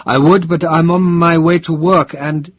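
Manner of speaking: stammering excuse, caught off guard; flustered. A narrator's reading. Flustered Hans.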